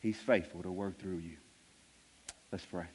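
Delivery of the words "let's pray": ordinary talk, nothing marked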